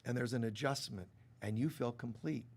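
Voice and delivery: ordinary talk, nothing marked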